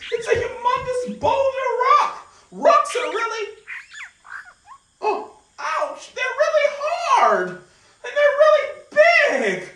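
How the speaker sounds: high, loud, laughing climbing play; breathless pretend adventure; excited play. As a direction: squeal and strain over the big rock. Pretends climbing over a boulder.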